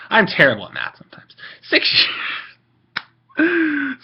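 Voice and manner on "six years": surprised. Real, not acted. Exclaims after bad math.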